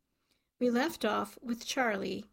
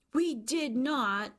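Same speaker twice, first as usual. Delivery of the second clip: higher, slow, drawn-out protest; emphatic denial; 4 semitones higher, slower than usual. A narrator's reading. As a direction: stretch out the indignant child's denial. Children repeat denial more emphatically.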